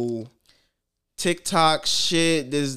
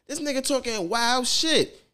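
usual speaking voice

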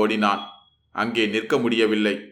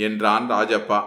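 usual speaking voice

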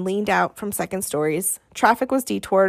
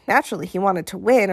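ordinary talk

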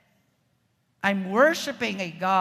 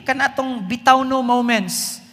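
normal talking voice

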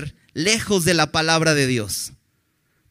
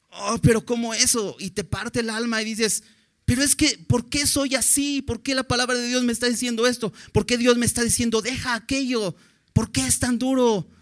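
higher, pleading questions; anguished believer; 7 semitones higher than usual. Retold or imitated. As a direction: voice a hurt listener asking why. Zuhörer klagt über Gottes Wort.

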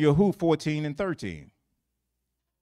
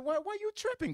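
mock retort; 5 dB quieter than usual. Retorts to the complainer.

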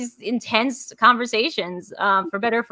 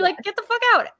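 high-pitched repeat; 11 semitones higher than usual. Retold or imitated.

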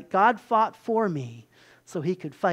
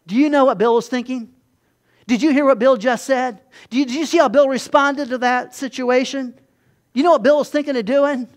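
louder, higher tattling accuser; accusing Satan; 4 semitones higher, 7 dB louder than usual. Retold or imitated. Satan accusing Bill before God, loud tattling voice. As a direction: rat Bill out to God, insinuating.

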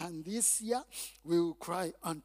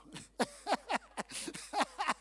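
high chuckling laugh, softening; 10 semitones higher than usual. Real, not acted. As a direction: laugh at your own joke. Laughs at own neighbor joke.

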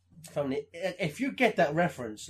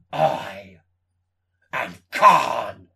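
dramatic reveal; 9 semitones lower, 5 dB louder, much slower than usual. Retold or imitated. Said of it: "He's like".

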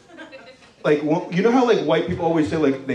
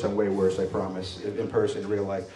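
"I'm way worse" repeated as a softer, lower throwaway; quiet aside; 7 semitones lower, 8 dB quieter than usual. Real, not acted. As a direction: drop it under your breath as an afterthought. Joke doubled as quiet aside.

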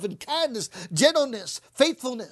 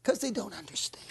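low whisper; whispered aside; 7 semitones lower, 11 dB quieter than usual. Real, not acted. Preacher whispering to the congregation. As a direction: say it under your breath.

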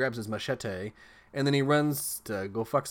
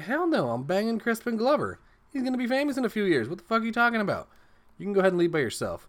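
high, fast, hammed-up bratty refusal; a twin refusing to leave the party, high fast mock sass; sassy girl voice; 11 semitones higher, 5 dB louder than usual. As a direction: squeal it high and quick like a defiant teen.

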